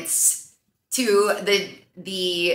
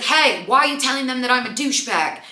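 higher-pitched mock confrontation; mildly indignant retort; 6 semitones higher than usual. British guy confronting the older guy, mock indignant. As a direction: imitate an indignant challenge. Acted quarrel between two men.

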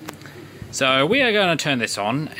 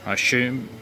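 ordinary talk, nothing marked

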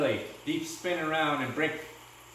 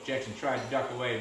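plain reading voice

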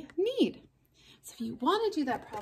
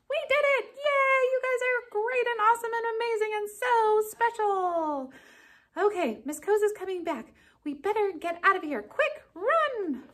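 higher, louder puppet joy; excited puppet cheer; 9 semitones higher, 4 dB louder than usual. Acted. Puppets celebrate, flee.

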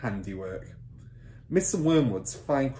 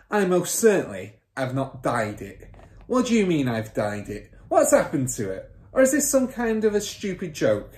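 mildly raised, indignant protest; father's indignant yell; 6 semitones higher, 4 dB louder than usual. A narrator's reading. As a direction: protest crossly, building in pitch. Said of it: "the father yelled".

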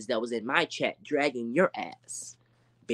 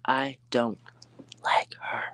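breathy whisper of a mocking question; whispered aside; 4 dB quieter, slower than usual. Real, not acted. Vlogger whispering to chat about the rival, hushed aside. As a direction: drop to a whisper as if sharing a secret.

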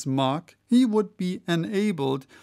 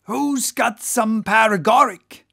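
higher, louder whaler calling to his crew; Stubb the whaler; 5 semitones higher, 7 dB louder than usual. Acted. Whaler to his crew about the sick whale, calling out. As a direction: play a whaling officer hollering for help. Voices a story figure.

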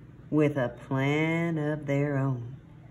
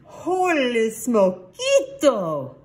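loud, high, drawn-out exclaim; excited exclamation; 8 semitones higher, 6 dB louder, slower than usual. A narrator's reading. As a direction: exclaim it big, stretching the words. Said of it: "exclaimed Skippy John Jones".

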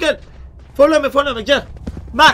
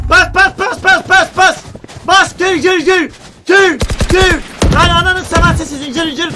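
loud, high calls to rush in; urgent shouting; 5 semitones higher, 6 dB louder, slower than usual. Real, not acted. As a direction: call everyone in fast and hard. Calling team to rush in. (bağırarak çağıran)

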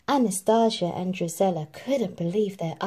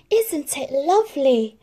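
higher, bright delight; delighted Cinderella; 8 semitones higher, 5 dB louder than usual. A narrator's reading. Cinderella shows her mother's gown to mice, delighted. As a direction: show off the treasure happily.

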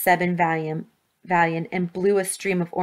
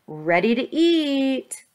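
high, stretched-out call; yelled bait; 6 semitones higher, much slower than usual. A narrator's reading. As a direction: sing it out to lure the horde. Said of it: "he yelled".

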